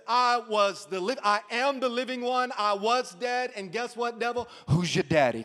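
preacher's own cocky taunt to the devil; taunting paraphrase. Pretend. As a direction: rub it in the devil's face. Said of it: "I'd say it like this".

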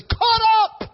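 preacher rejoices over the rapture; excited exclamation. Real, not acted. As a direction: lift it up with joy.

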